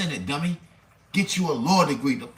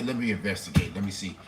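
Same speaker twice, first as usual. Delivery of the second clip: lower, soft, patronizing cop voice; lightly voiced soothing officer, brushing you off; mock calm cop; 8 semitones lower, 3 dB quieter than usual. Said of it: "they gonna say".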